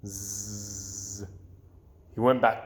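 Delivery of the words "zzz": soft snoring; sleeping girl snores softly. A narrator's reading. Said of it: "Amy snored".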